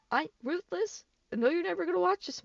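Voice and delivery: ordinary delivery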